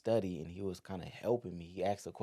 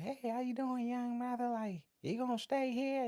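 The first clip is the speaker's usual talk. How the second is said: put-on high sweet old lady voice, much higher; elderly church sister. Elderly sister welcoming a young visitor, high and sweet. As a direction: greet the newcomer like a kindly church elder. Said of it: "the elderly sister".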